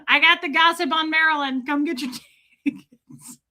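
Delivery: mock ticket scalper. Streamer spoofs selling gossip on Marilyn, laughing scalper's call. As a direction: play a scalper, cracking up at the end. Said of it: "he becomes a scalper".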